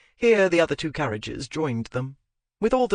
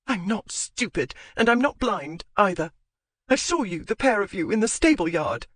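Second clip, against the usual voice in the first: angry shout; 6 semitones higher than usual. Acted. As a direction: throw it back at him. Wife shouting back.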